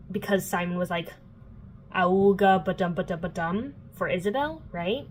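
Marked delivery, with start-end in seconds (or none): 1.9-3.7 goofy awooga and heartbeat noises; cartoon ogling noise. Simon smitten with Isabel, cartoon ogling noise. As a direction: mimic a lovestruck cartoon wolf.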